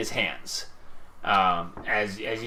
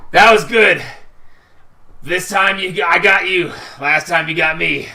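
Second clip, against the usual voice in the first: hearty praise; 4 semitones higher, 7 dB louder than usual. Acted. Victor slaps loser, friendly.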